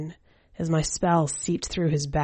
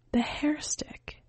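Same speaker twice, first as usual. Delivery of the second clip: whisper; 10 semitones higher, 6 dB quieter, slower than usual. Acted. Coaxing the entranced old man.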